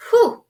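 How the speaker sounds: loud high whew of relief; relieved whew. Real, not acted. Narrator relieved after the rescue, loud high whew. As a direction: blow out the tension. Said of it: "that was a close call".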